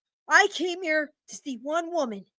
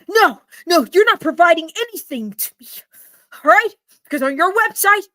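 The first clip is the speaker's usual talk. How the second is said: angry accusations; 5 dB louder than usual. Acted. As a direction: hammer each accusation. Customer shouts accusations.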